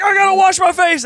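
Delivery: high, loud frantic yell; panicked shout. Retold or imitated. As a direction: blurt it out in full panic. Panicking in bathroom.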